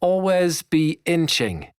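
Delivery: put-on high, slow British-accented coaching line; genteel British accent. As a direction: press it home in a refined British accent. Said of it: "in his genteel British accent".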